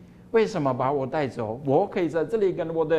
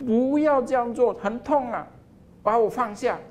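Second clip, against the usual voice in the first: high, urgent begging in pain; pained pleading; 8 semitones higher than usual. Acted. Cup cries out to the potter in pain, high pleading. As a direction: plead to be put down, hurting.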